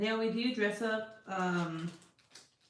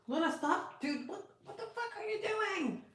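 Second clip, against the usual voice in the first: much higher exasperated cry; 12 semitones higher, 4 dB quieter than usual. Real, not acted. To Luna destroying things, high exasperated cry. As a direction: squeal at the cat in disbelief.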